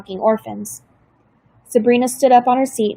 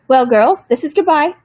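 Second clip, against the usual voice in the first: brisk caseworker farewell; 4 semitones higher, 5 dB louder than usual. A narrator's reading.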